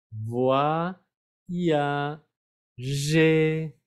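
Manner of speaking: "Voix, ya, G" as "vwa... ya... zhé", pauses between; three-syllable breakdown. Shown as a demonstration. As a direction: spell out "Voix, ya, G" as separate syllables. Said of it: "la palabra en tres sílabas".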